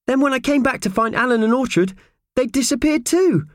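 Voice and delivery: very high, fretful character voice; unhappy small Purvis. Acted. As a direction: explain the mishap in a high, worried voice. Purvis keeps explaining unhappily.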